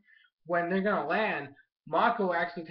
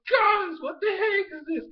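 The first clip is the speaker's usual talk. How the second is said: exasperated disbelief; 13 semitones higher, 4 dB louder than usual. Real, not acted. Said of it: "I'm like".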